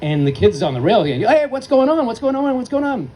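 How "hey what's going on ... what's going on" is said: calling out. Retold or imitated. Calling to upset kid on rail.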